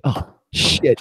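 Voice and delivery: whispered oh shit; panicked oh shit. Retold or imitated. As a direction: breathe it out in mock panic. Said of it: "he's like".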